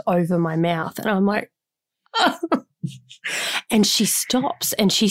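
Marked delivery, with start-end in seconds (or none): 2.1-2.6 high hammed-up fake sob; a short pretend wail into the hand; fake cry. Mum fake-crying to her daughter, high mock sob. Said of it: "I fake cry".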